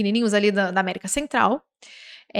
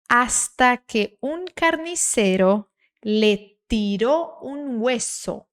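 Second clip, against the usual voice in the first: very slow, measured Spanish read-aloud; slow careful reading; much slower than usual. Shown as a demonstration. Teacher reading a Spanish text for learners, very slow and careful. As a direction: read it unhurried, stretching each phrase with long pauses. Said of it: "Devagarzinho, hein? Sem pressa".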